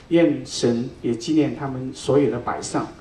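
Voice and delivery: ordinary talk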